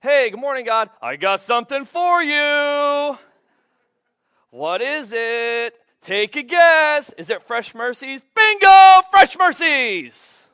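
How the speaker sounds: high, loud, drawn-out game-show excitement; giddy playful dialogue. Pretend. Preacher acting out a morning guessing game with God, squealing excited. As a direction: play it like a guessing game with a prize.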